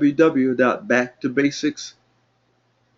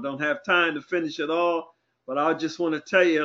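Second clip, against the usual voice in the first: ordinary talk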